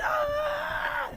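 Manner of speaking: sudden high yelp; surprised yelp. Real, not acted. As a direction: blurt out a startled cry. Sudden outburst mid-thanks.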